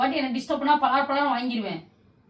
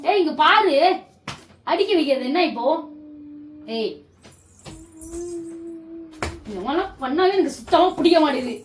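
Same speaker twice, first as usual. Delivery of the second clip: loud, higher, heated telling-off; angry scolding; 6 semitones higher, 5 dB louder than usual. Real, not acted. Scolding her husband for the night prank, loud angry. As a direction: let him have it.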